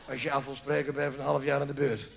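usual speaking voice

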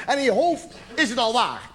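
ordinary talk

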